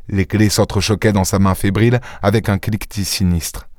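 ordinary delivery, nothing marked

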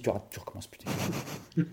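hushed scolding whisper; whispered warning. Pretend. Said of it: "on lui a dit". (chuchoté, sur un ton de reproche)

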